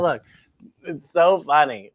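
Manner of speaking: ordinary delivery, nothing marked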